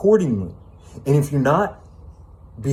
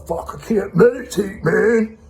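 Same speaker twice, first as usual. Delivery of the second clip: higher, mock-exasperated complaint; frustrated meditator; 9 semitones higher, slower than usual. Mocking people who fail at meditation, mild mock exasperation. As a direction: voice a frustrated beginner, lightly. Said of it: "They're like".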